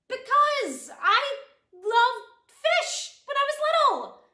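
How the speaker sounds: high, loud, slow burst; excited gushing. Acted. Explaining a goldfish gift to a date, excited gushing. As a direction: blurt it out, stretching the words high.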